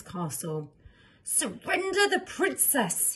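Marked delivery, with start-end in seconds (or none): 1.2-3.1 high, slow frightened demand; a scared hero trying to sound brave; shaky yell. Acted. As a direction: yell it while trembling with fear. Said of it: "he yelled, while shaking in his armour".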